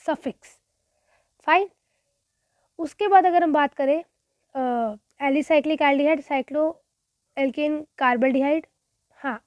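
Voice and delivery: ordinary delivery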